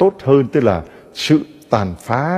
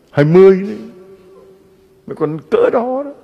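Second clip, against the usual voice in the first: mock exclaimed answer; 9 semitones higher, 3 dB louder than usual. Priest mock-answering a question.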